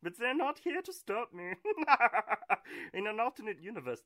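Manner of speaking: high, loud put-on silly voice; annoying voice. Host teasing absent co-hosts in annoying voice. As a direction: ham up a grating goofy voice. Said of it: "I'm doing the annoying voice".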